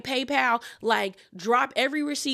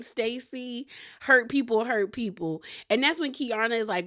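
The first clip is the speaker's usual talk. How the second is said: ordinary talk, nothing marked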